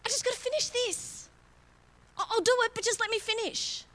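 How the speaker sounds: put-on high, childish pleading; high kid's voice putting mum off; whiny kid stalling.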